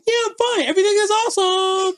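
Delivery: very high sing-out imitation of her; mock-bright squeak pretending all is well; falsely cheerful. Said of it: "you hear in her voice".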